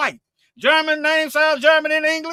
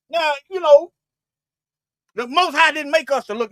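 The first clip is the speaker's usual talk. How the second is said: usual speaking voice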